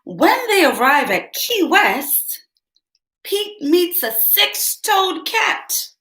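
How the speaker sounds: lively picture-book read-aloud; bright storybook narration; reading to children. A narrator's reading. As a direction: read it like story time for kids.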